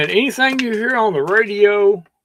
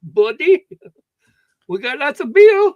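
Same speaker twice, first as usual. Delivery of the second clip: mock Indian accent; 8 semitones higher, 5 dB louder than usual. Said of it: "Go back to India".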